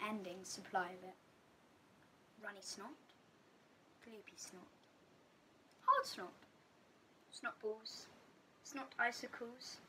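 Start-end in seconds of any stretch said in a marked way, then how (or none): 4.1-4.6 whispered gloopy. Acted. Listing kinds of snot. 5.9-6.3 high hard. Acted. Listing kinds of snot.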